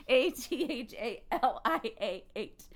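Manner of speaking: one letter at a time; spelling out. Real, not acted. Spells the name out.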